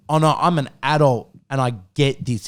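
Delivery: ordinary delivery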